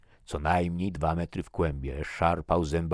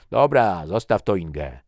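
loud reply called from a distance; raised-voice dismissal; shouted back; 4 semitones higher, 12 dB louder than usual. A narrator's reading. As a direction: holler back high, easing off on the name. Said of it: "odkrzyknął Svensson".

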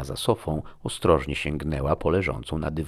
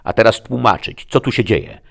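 high, loud sheriff's command; forceful, raised-pitch order; loud demand; 7 semitones higher, 9 dB louder than usual. A narrator's reading. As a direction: demand answers with authority.

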